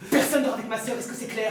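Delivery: loud, forceful order; stern warning. Acted. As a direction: lay down the law, loudly. Brother warns friends off his sister. (avertissement ferme et fort)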